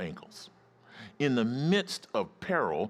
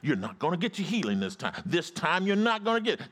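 mock devil in a raised pitch; lightly acted, needling devil; voiced devil; 7 semitones higher than usual. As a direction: taunt as a high-voiced devil. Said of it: "He said".

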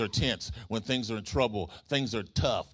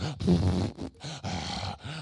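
long, forceful mock sigh; puffed-out breaths of barely held patience; exasperated huffing; 4 semitones lower than usual. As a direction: huff like you're about to blow a fuse. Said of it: "you about ready to blow a fuse".

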